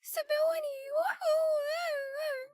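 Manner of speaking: high, excited whoop; excited squeal. Real, not acted. Streamer cheering to her chat, excited high squeal. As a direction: cheer it out like a win.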